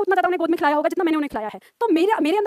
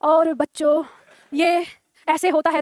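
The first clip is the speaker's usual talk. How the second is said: Atal ji style; much slower than usual. Pretend. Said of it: "Atal जी की तरह बोलूंगी थोड़ा".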